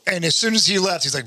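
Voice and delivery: ordinary talk, nothing marked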